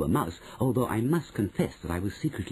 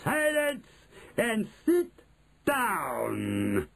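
high order dropping on a long last word; imperious witch, pausing before the final word; commanding witch; 12 semitones higher, much slower than usual. A narrator's reading. Witch orders the audience to sit.

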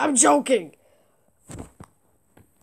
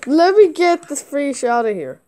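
usual speaking voice

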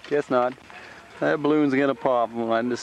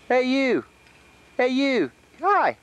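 high-pitched "hey you", dropping at the end; high calling greeting; 10 semitones higher, slower than usual. Real, not acted. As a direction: call out a high, singsong hello. Calling to someone to greet them.

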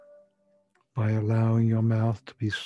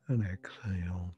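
whispering; 4 semitones lower, 8 dB quieter than usual. Acted. Breathing cue in meditation.

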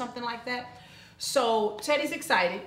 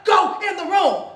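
slow, loud, higher shout; shouted order; 8 semitones higher, 9 dB louder, slower than usual. Retold or imitated. Father finally shouting his daughter into her room. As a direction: yell the order one last time. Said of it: "he was like".

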